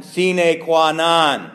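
"Sine qua non" drawn out slowly and loud; solemn slow "Sine qua non"; slow Latin phrase. Shown as a demonstration. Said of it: "We say in Latin".

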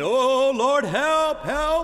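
high, loud whining cry for help; mock desperate plea. Preacher mimics believers pestering God, whining for help. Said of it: "we're always saying".

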